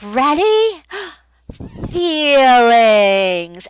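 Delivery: loud, high with a gasp before Feelings; excited short prompt. Shown as a demonstration. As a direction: spark wonder with a gasp. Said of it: "Short, to the point".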